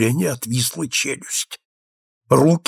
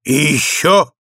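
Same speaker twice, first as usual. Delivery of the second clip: higher-pitched, moderately forceful roar of admiration; delighted roar; 6 semitones higher, 4 dB louder than usual. A narrator's reading. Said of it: "заревел он". (восторженный рёв)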